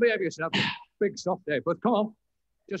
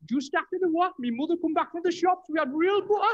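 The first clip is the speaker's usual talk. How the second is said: hammed-up impression; 8 semitones higher than usual. Comic sidekick shouting a daft impression at his partner. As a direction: squeal out the memory in a silly put-on voice. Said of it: "On the other hand".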